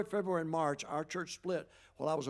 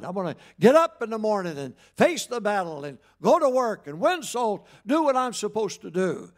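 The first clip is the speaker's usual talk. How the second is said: defiant declaration; 2 semitones higher, 8 dB louder than usual. Retold or imitated. Retold defiant talk to choir.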